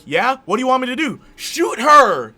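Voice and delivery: high, loud frantic yelling; hammed-up urgent shouting. Man asking for orders, heroine ordering the shot, shouted in mock urgency. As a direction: build to a big mock-desperate scream. Acted shouted climax of invented scene.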